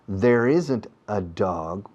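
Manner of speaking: very slow, each word clear in "There isn't a dog"; teacher's slow demo; slow model sentence. Shown as a demonstration. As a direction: spell it out slowly for learners. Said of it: "English model sentence".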